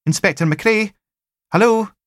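high lilting Highlands and Islands call; loud lilting Highland hail; sing-song Highland accent. A narrator's reading. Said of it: "sing-song Highlands and Islands accents".

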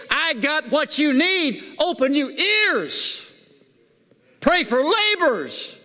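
high, drawn-out preacher's cry; excited and enthused. Acted. Preacher voicing Jesus to the lost, excited and enthused. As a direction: call it out high, stretching each key word. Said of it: "he was not excited and enthused and say".

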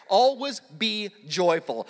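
"Always be joyful" spoken slowly, word by word; slow recitation. Shown as a demonstration. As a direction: recite the verse slowly so they memorize it. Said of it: "You memorized a Bible verse right there".

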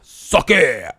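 loud mock insult in English. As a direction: bark it out mockingly. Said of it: "You'll be all like".

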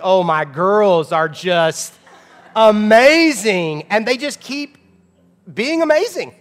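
drawn out, loud doting dad; gushing. Retold or imitated. Dad answering how his kids are, gushing about his girls. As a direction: gush slowly, pitch climbing on amazing.

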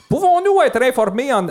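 high citizen voice rolling the R's; rolled R's. Acted. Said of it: "je décidais que j'allais rouler des R".

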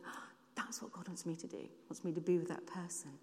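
put-on whispered quote of a girl realising; hushed realisation. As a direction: breathe it out softly as a discovery. Girl's quiet realisation at doorstep.

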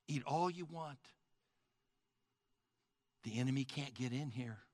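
soft, loving king speaking to his guest. Acted. King tenderly invites the soldier to his banquet, soft and loving. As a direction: gently welcome a beloved guest to the feast. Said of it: "he says to you in a loving voice".

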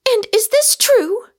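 very high, loud question; agitated demand. A narrator's reading. Rosamond challenges Michael's claim of madness, agitated demand. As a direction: demand the truth with voice pitched right up.

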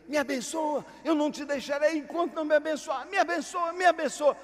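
cling on and beg; desperate plea. Acted. Jacob begging while wrestling.

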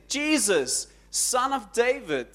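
screamed plea. Retold or imitated. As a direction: call out high, then soften on the plea. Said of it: "And he screams".

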